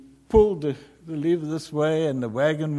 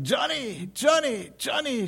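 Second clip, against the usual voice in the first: shouting for Johnny; 10 semitones higher than usual. A narrator's reading. Mother calling for her lost son, frantic shout. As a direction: call out his name in panic. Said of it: "she ran out shouting".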